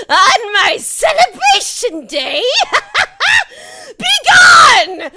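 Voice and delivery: high, loud cackling villain shout; flame skull curse. Acted. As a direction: hurl the curse with mad glee.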